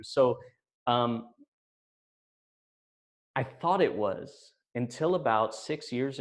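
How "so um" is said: usual speaking voice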